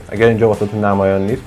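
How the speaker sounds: ordinary talk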